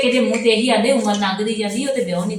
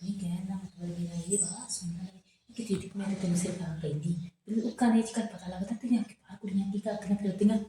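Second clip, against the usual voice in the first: low, much softer, secretive; hushed gossip; 3 semitones lower, 12 dB quieter than usual. Acted. Friend gossips to her sister-in-law, hushed and much softer. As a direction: lean in and drop the voice to share a secret.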